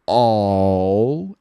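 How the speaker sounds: All said slowly with an aw vowel. Shown as a demonstration. Teacher contrasting all with owl. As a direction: draw out the aw sound. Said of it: "Olha a diferença aqui".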